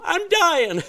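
high, loud mock-desperate wail; mock panic. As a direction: play up the drowning panic for laughs. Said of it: "You're going".

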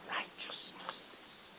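slow, drawn-out whisper; hushed emphasis. Real, not acted. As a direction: whisper the word reverently slowly. Verse's last word dropped to whisper.

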